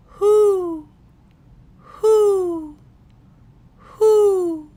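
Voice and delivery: high, loud hooting owl call. A narrator's reading. Pa calling to the owl in the night woods, high loud hooting. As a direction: call out like a great horned owl, high and ringing. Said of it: "Again he called out".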